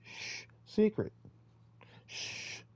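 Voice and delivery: hushed, breathy shh of a secret; shushing whisper. Shown as a demonstration. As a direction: shush them like a shared secret. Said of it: "in secret".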